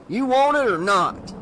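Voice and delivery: Tupper demanding. Acted. Sheriff Tupper demands the salesman take the key, impatiently. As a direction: push him for an answer. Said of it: "Tupper demanded".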